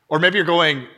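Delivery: normal talking voice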